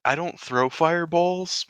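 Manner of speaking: ordinary talk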